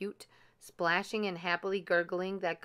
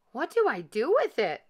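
higher, louder cry for help; dismayed cry; 6 semitones higher, 5 dB louder than usual. A narrator's reading. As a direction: cry out at a loss what to do. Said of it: "Gus cried".

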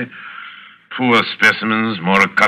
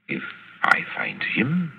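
menacing whisper; 4 semitones lower, 11 dB quieter than usual. Acted. Villain describing the hunt.

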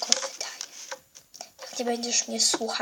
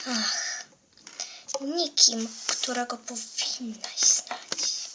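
sinister whisper; 2 semitones lower, 5 dB quieter, slower than usual. Acted. Villain hides identity.

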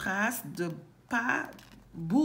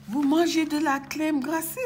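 higher, rising astonished question; surprised exclamation; 6 semitones higher, 4 dB louder than usual. Acted. Boucle d'Or questions bébé ours, surprised. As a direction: ask it in raised, lively surprise. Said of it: "s'exclame Boucle d'Or, surprise".

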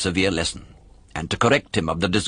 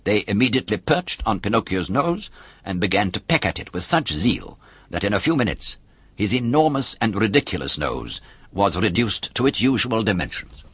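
usual speaking voice

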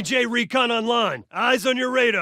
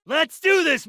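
higher, louder, pumped up; rally the squad; excited battle cry; 6 semitones higher, 4 dB louder than usual. Acted.

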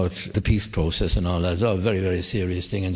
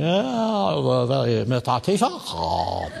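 lightly voiced foreign dignitary; a hint of a fawning host greeting; Chinese Prime Minister; 2 semitones higher than usual. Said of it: "Prime Minister is saying".

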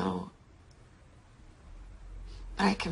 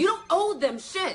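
high-pitched, heated interruption; indignant outburst; angry retort; 9 semitones higher than usual. Acted. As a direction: cut in hotly, pitch way up. Quarrel, she cuts in.

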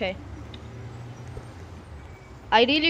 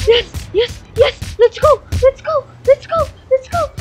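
high, loud, rapid-fire celebration; excited cheering; 13 semitones higher, 7 dB louder than usual. Real, not acted. Celebrating in the game.

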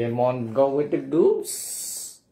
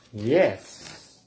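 ordinary delivery, nothing marked

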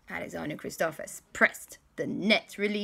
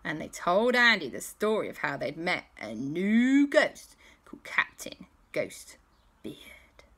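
ordinary delivery

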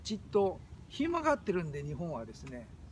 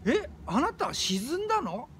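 higher, slower puzzled question; mock surprise; 7 semitones higher than usual. Listener mishearing "think" as "sink", mock surprise. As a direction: act out a puzzled listener's double take.

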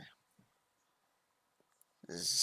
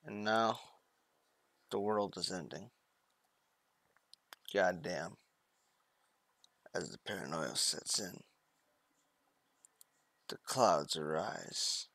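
dramatic narration; 5 semitones lower, 8 dB quieter than usual. Pretend. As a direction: narrate the end of the world gravely. Mock dramatic doomsday narration.

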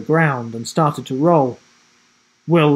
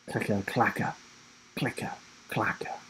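low, near-whispered creature sound; hushed menacing clicking; 4 semitones lower, 13 dB quieter than usual. A narrator's reading. Narrator voices the Void approaching between trees, hushed clicking. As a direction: click it out softly, pausing between pairs.

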